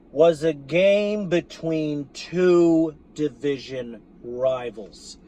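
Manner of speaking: slow, spelled-out delivery; slow and patronizing; real slow. Real, not acted. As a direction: drag each phrase out for the slow ones. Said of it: "So I'ma say this real slow".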